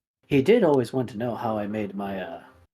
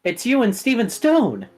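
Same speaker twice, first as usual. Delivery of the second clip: excited announcement; 10 semitones higher, 4 dB louder than usual. Acted. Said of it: "He goes".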